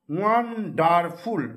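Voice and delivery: ওয়ান্ডারফুল drawn out slowly, each part clear; slow demo pronunciation. Shown as a demonstration. Teacher to class, slowing the word down. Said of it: "ওয়ান, W A N".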